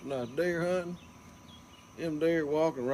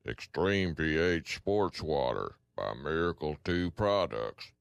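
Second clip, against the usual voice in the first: much lower, movie-trailer announcer read; deep announcer voice; 9 semitones lower than usual. Acted. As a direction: drop way down like a commercial voice-over. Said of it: "How'd you do your voice like that?".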